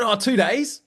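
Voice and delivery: high, cheeky, grinning answer; new hire grinning as he admits two days; cheeky grin. Retold or imitated. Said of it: "with a big cheeky grin on my face".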